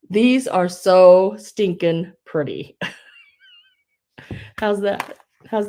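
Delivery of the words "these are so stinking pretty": louder and slow, savoring each word; gushing, slow and loud. Real, not acted. Seller admiring the hankies to viewers, loud slow gush. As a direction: gush over them.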